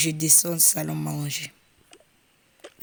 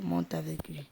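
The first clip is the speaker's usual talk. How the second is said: ordinary delivery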